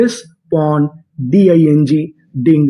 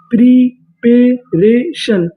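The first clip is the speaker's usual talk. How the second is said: very slow syllables; 3 semitones higher, much slower than usual. Shown as a demonstration. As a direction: draw out preparation syllable by syllable, very slowly.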